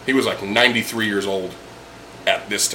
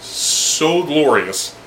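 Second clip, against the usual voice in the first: drawn out; 3 semitones higher, much slower than usual. Real, not acted. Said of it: "I can't describe it with".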